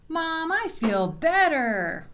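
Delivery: put-on a boy telling mom he feels better, excited; excited shout. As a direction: call it out to mom, thrilled. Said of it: "he kind of shouted it out".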